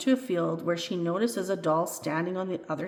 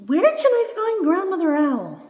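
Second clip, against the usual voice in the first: eager question; 11 semitones higher, 8 dB louder than usual. A narrator's reading. Doll eagerly asks for directions.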